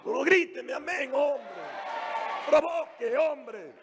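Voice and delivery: high, fired-up pulpit preaching; rally the church from the pulpit; preaching at full force. Acted. Pastor rousing the congregation with fiery preaching.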